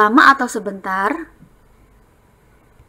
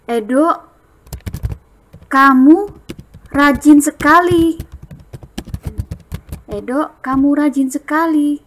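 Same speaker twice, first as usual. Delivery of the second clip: louder, higher delighted praise; praising; 5 semitones higher, 4 dB louder than usual. Shown as a demonstration. Teacher modelling praise for a tidy bed. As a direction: cheer Edo on for tidying. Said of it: "kalimat pujiannya bagaimana?".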